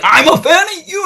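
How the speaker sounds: very high, excited squeal of a voice; high mock fangirl voice; gushing fan. Retells actress's eager answer.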